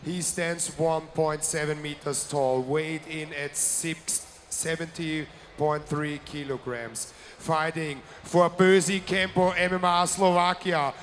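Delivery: loud, booming fight announcer; ring announcer. Acted. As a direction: announce it like a ringside MC. Ring announcer introducing blue corner.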